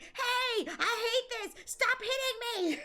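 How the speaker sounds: high-pitched mock whining protest; Scott. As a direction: imitate a silly villain squealing under attack. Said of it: "he's like".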